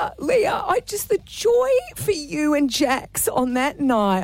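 high voice breaking into tears, then settling; tearful, moved. Real, not acted. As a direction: greet a friend while welling up. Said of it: "I'm still in those tears".

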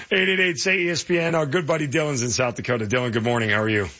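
ordinary talk

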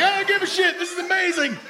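very high, thrilled squeal; ecstatic. Acted. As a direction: squeal with mock elation mid-ride. Acted thrill while swung upside down.

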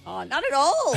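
mocking Jonesy. Co-host teasing Jonesy's denial, mock parroting. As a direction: tease him by parroting his words. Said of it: "says Jonesy".

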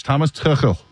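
Tuchel said the German way, with a throaty ch; German pronunciation. Shown as a demonstration. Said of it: "is how I'm gonna say it with my, uh, German background".

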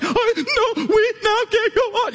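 very high, squealing mock sobs; can't-talk crying. Bawling husband answering his amazed wife, imitated squeaky crying. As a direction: wail the words as if too choked up to talk. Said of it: "I can't even talk type crying".

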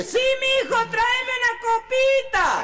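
high, slow, stretched holler; old man's distant shout. Acted. Tata answering his boy across the fields, slow high shout. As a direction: holler the reply so it carries far. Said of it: "Y el Tata le dice".

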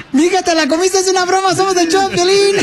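gleeful high-pitched gotcha; excited prank reveal. Real, not acted. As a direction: crow the big reveal, pitch way up. Host yells out the prank reveal.

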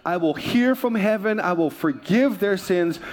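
normal talking voice

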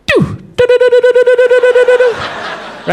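high, rapid-fire electronic doo-doo-doo; machine-gun run of high doos; crossing signal noise. Retold or imitated. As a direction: imitate a street crossing beeper, very high. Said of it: "this is what I hear".

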